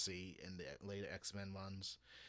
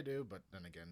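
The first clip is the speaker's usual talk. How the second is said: ordinary talk, nothing marked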